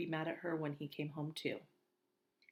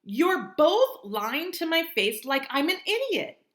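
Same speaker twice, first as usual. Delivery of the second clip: slow, loud, high furious yelling; furious shout; 11 semitones higher, 14 dB louder, slower than usual. Acted. Augie yells at Mom and Via for lying. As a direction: spit each word out in rage.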